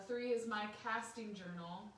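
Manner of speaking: plain reading voice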